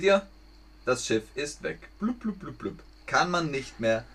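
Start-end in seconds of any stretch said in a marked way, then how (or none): 2.0-2.8 bubbling sound effect. Retold or imitated. Ship sinking sound.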